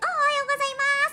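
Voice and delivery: high, bright-edged cutesy Japanese greeting; cutesy anime greeting. Shown as a demonstration. Showing the Japanese original again, high and cutesy. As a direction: sing it out like a cute anime character. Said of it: "a middle ground where it's like".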